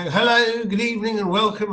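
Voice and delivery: high, loud energetic TV host greeting; David Frost. Retold or imitated. Frost opening his show with high loud energy. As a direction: greet the audience like a bright chat-show host. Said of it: "from being, you know".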